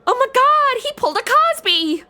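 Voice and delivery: high and loud, outraged disbelief; shocked outburst. Acted. Woman realizing the victim drugged her, loud and high outrage. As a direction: blurt it out in horror.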